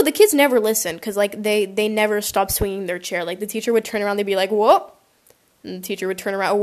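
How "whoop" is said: short whoop sliding upward; rising whoop. Retold or imitated. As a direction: imitate a chair tipping with a whoop. Imitates kids tipping their chairs.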